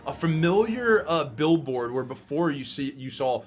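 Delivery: low, measured scene description; scene narration. A narrator's reading. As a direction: paint the monster slowly and ominously. Game master describes the monster.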